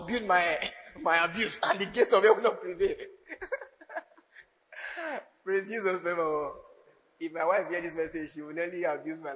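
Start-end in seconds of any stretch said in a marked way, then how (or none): 2.9-6.5 laughing. Real, not acted. Laughs at his own joke.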